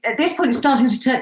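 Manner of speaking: ordinary talk, nothing marked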